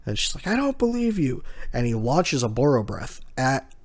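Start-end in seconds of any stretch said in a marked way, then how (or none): 0.5-1.4 high, lightly voiced defiant girl; defiant Nami. Nami defying Kaido, in a high defiant voice. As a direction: toss it back at him in a high girl's voice.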